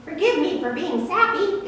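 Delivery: a hint of a sheepish little plant; apologetic grape branch. A branch apologises to the farmer, lightly voiced and sheepish. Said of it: "answered one more".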